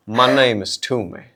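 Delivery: deadpan Southern sergeant introducing himself; Southern accent. Acted. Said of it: "a little bit of Southern accent".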